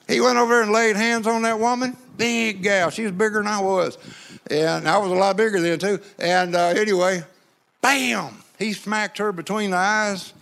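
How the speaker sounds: usual speaking voice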